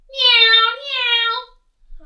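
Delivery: two loud, high engine whines; high swooping plane imitation; aeroplane noise. A narrator's reading. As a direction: zoom like a child playing planes. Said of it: "pretending to be an aeroplane".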